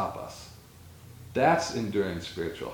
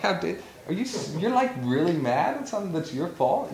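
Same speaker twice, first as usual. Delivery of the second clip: higher-pitched retold outrage; indignant disbelief, played for laughs; mock indignation; 7 semitones higher than usual. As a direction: act out incredulous anger, lightly. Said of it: "I started getting mad".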